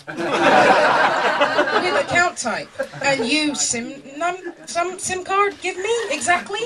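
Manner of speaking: slow, high, halting broken question; bad-German request. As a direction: grope through mangled words, pitch climbing at the end. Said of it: "I was like".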